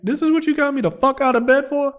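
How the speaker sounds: high-pitched mock indignation; retold indignant disbelief. Man complaining to the woman who woke him, mock indignant. Acts out his annoyed complaint to her.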